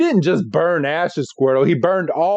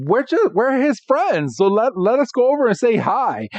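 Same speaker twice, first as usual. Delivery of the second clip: higher-pitched acted pleading; lightly voiced boyish protest, pitched up; Ash; 7 semitones higher than usual. Ash asks Team Rocket to let him pass, mock pleading. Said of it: "Ash is like".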